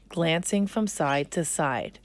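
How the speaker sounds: ordinary delivery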